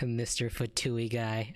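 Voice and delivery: usual speaking voice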